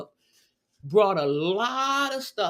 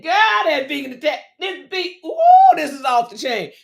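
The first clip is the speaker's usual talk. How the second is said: fast, high, rising to a loud squeal on ooh; excited praise; 8 semitones higher, 8 dB louder than usual. Retold or imitated. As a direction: gush over the food. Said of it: "She said".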